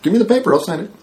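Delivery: high, eager and chipper. Retold or imitated. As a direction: happily agree to be fired. Mock eager acceptance retold.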